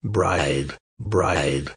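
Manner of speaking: low, soft, hollow-sounding voice of a dead horse's head; quiet, deep talking horse head; horse head's voice. Acted. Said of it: "And the head answered".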